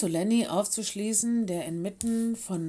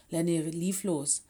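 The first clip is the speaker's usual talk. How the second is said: ordinary delivery, nothing marked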